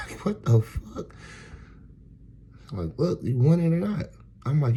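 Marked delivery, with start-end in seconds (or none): 3.0-4.1 put-on high, louder child's sales pitch; imitating pushy kid. Five-year-old pressing a buyer, imitated pushy high voice. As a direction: push the sale as a bossy little kid.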